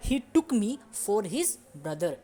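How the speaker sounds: very slow, careful English example; slow model sentence. Shown as a demonstration. Teacher modelling the English sentence slowly. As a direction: lay out each word slowly for the class. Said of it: "तो".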